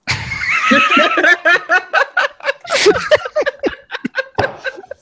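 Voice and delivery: a long, loud burst of high laughter. Real, not acted. Big laugh at joke.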